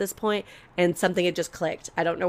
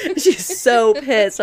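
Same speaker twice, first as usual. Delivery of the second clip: laughing; 5 semitones higher, 8 dB louder, slower than usual. Real, not acted. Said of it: "laughs while telling".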